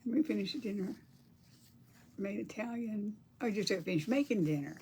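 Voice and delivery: ordinary talk, nothing marked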